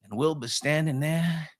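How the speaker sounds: high, drawling Down East storyteller; folksy joke telling. Acted. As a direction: spin the yarn like an old Mainer. Tells a folksy Maine joke.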